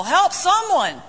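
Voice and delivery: high, loud frantic shout; yelling again. Retold or imitated. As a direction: call out desperately as the branch strains. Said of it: "He yells again".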